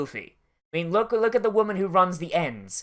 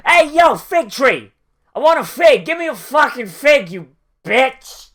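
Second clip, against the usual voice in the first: loud, high, bratty demand; mock angry yell; 7 semitones higher, 8 dB louder than usual. Jesus yelling at a bare fig tree, hammed-up loud demand. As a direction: holler at the tree like a spoiled kid. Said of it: "Jesus goes".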